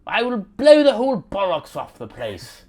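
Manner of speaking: over-the-top British movie villain; British criminal. Acted. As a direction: threaten them in a hammed-up British crook accent. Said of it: "criminal British guys".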